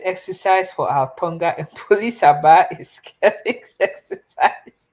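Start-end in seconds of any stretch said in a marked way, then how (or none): 3.0-4.9 slow, high, laughing through the words; giggling at her own cheeky joke. Real, not acted. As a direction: chuckle the words out.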